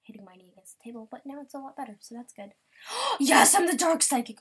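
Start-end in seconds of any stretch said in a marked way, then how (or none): 2.9-4.4 gasps and shouts it out high and loud; thrilled to get the role, squealing it out; excited gasp. Real, not acted. Gets the role she wanted.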